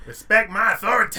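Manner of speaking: cartoon quote.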